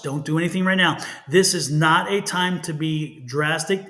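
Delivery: ordinary talk, nothing marked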